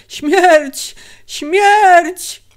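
high, loud cries of mortal dread; panicked shriek; terrified screaming. A narrator's reading. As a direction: scream it out, crazed by pain and fear. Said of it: "Teraz już krzyczy bez przerwy, opętany bólem i trwogą".

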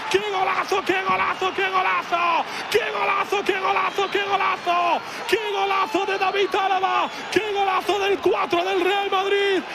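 high, excited goal shout; breakneck goal commentary. Real, not acted. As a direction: scream the goal over and over.

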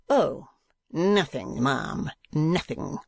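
slow, bitter drawl; rough and bitter. A narrator's reading. Uncle answering the madam, rough and bitter. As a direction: draw the words out with rough scorn. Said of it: "in the rough and bitter voice".

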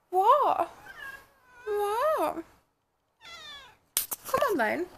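high cooing pet voice. Real, not acted. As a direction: coo up high at the cat. Calling to her cat.

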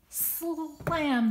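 high, loud sound word; crashing slam. A narrator's reading. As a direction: punch it out. Sound effect word in story.

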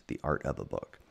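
ordinary delivery, nothing marked

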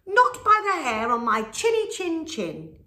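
high loud piggy refusal; high defiant little pig. A narrator's reading. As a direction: refuse the wolf boldly. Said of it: "said the first little pig".

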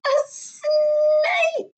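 put-on high, panicked child cry; alarmed yell. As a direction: shriek it as a scared little boy. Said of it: "Neeraj yelled".

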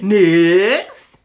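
loud announcer call. Real, not acted. Announcing the next candle to viewers, loud call. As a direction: shout it like calling the next contestant.

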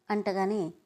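plain reading voice

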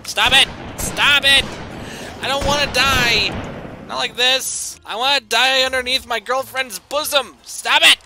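high, loud hammed-up panicked pleading; mock panic. Begging the game to stop killing him, hammed-up panic.

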